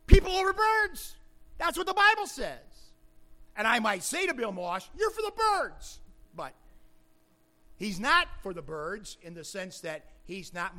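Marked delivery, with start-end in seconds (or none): 0.1-6.0 high, loud pulpit exclamation; full-force preaching. Real, not acted. Preacher declaring what the Bible says, full-force preaching. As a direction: ring it out as the clinching point.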